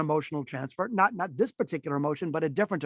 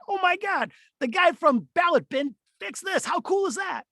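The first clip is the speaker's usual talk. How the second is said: much higher, gushing excitement; enthusiasm voice; 10 semitones higher than usual. Shown as a demonstration. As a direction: pitch way up like a thrilled fan. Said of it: "So my voice starts to rise up".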